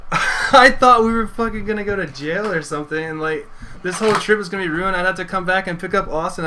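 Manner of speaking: high-pitched, agitated venting; worked-up protest. Retold or imitated. Venting about nearly going to jail, high-pitched and agitated. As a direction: let the pent-up scare burst out.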